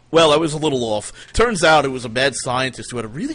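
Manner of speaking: normal talking voice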